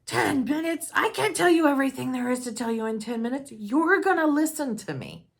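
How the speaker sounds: exasperated father protesting the time limit; indignant dad. Retold or imitated. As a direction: imitate the father's loud indignant complaint. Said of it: "And he says".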